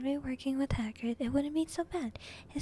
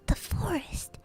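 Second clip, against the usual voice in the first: raised, louder startled repeat; losing his cool; 6 semitones higher, 5 dB louder, slower than usual. A narrator's reading. Boy repeating the dreaded destination, cool slipping. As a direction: blurt it out, uneasy. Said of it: "didn't sound quite as cool as usual".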